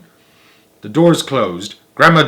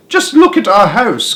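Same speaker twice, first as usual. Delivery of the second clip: high, loud, slow lament; dismayed cry; 10 semitones higher, 6 dB louder than usual. A narrator's reading. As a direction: wail over the ruined house. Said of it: "cried poor Mister Bucket".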